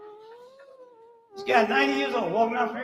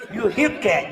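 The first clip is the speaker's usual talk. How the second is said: ordinary talk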